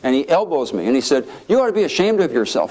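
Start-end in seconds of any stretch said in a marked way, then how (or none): 1.5-2.7 higher-pitched retold reproach; indignant scolding. Congressman elbowing his irreverent colleague, scolding. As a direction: chide a friend in mock indignation.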